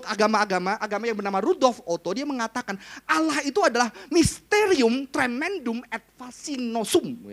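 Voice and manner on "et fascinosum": et fascinosum, slowly sounding out the Latin; careful Latin. Shown as a demonstration. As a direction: spell out et fascinosum syllable by syllable. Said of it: "semoga pelafalan saya benar". (mengucapkan et fascinosum pelan dan hati-hati)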